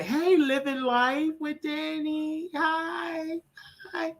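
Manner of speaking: very high, slow singsong hello; high greeting. Acted. Chirping a high hello to a viewer. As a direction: squeal hello to the viewer like a cartoon.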